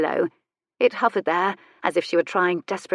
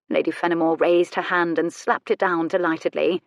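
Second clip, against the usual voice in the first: ordinary delivery, nothing marked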